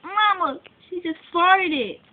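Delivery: loud tattling call to mom; yelling across the room. Acted. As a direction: call out to mama.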